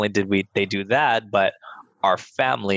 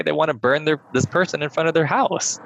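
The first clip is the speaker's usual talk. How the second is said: incredulous, pitch climbing higher to the end; appalled, rising protest; retold outrage; 7 semitones higher than usual. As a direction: build in disbelief towards the last words. Retold outrage at classmates' threat.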